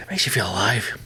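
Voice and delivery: hushed whisper for the punchline; whispered aside. Real, not acted. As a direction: lean in and whisper it. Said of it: "whispered punchline".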